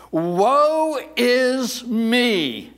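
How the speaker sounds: loud, drawn-out lament, pitched high; crying out. Acted. Preacher voices Isaiah seeing God, crying out. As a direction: cry each word out slowly, like a prophet. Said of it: "he cried in response".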